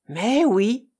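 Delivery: plain reading voice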